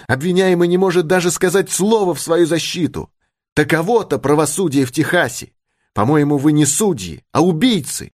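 high-pitched indignant scolding; angry reproach. A narrator's reading. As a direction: shame them with a high, angry voice. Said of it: "воскликнула она гневно". (гневный упрёк высоким голосом)

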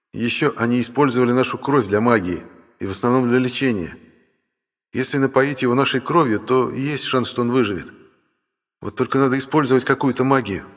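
dragon brother. Acted. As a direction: reason it through as the brother.

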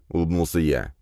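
plain reading voice

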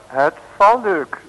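slow, loud and high villain line; gloating villain springs the trap; villain's loud announcement. Acted. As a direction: boom it out triumphantly.

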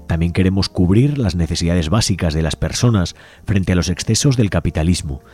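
ordinary talk, nothing marked

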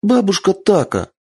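put-on high excited girl calling out; little girl calling. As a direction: call her like an eager child. Young fox girl calls out.

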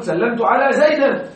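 زيدا pronounced "Zaydan" as a wrong example; wrong accusative ending. Shown as a demonstration. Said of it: "لا تقل".

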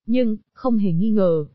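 ordinary delivery, nothing marked

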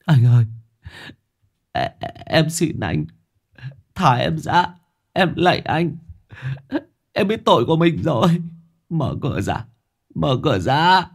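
high, sobbing plea; tearful begging. A narrator's reading. Captive begging his jailer through the door, tearful. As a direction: beg for mercy through tears. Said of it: "tiếng khóc rấm rứt, cùng với tiếng van xin".

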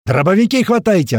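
high, loud battle order; all-out yell. A narrator's reading. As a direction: yell with all your might. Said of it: "ору, что есть мочи". (крик что есть мочи)

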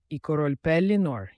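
plain reading voice

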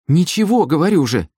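"Ничего" thrown out high, insisting; insistent denial. A narrator's reading. Sister pressed by her brother, "Ничего" high and insistent. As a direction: protest "Ничего" in a high, defensive voice.

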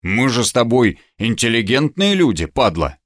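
ordinary delivery

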